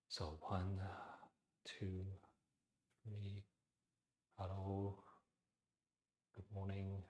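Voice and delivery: soft whisper with a narrowed throat; slow whispered counting. Shown as a demonstration. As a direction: count and greet under your breath. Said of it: "imagine I'm whispering".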